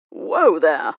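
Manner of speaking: loud, sudden catch; steady her with a shout; a man catching a swooning lady; calling out. Acted. Catching a fainting woman.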